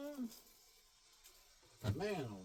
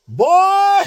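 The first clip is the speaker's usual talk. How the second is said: angry shout; 20 dB louder than usual. Real, not acted. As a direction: explode on the word like a warning. Ranting at hidden enemies.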